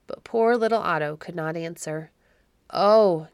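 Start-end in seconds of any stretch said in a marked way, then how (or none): 2.7-3.3 loud, anguished "Oh"; narrated gasp of anguish; strangled gasp. A narrator's reading. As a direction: cry out in grief, lightly strangled. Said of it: "gasped the G-, Baron in a strangled voice".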